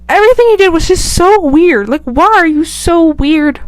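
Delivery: high, loud, incredulous rant; baffled exasperation. Real, not acted. Vlogger rants about Jacob's behaviour, baffled exasperation. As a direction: demand an explanation, stretching the question.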